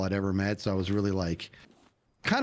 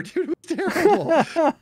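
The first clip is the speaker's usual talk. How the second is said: high laughing words; 7 dB louder, slower than usual. Real, not acted. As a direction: laugh through the line. Laughing while telling.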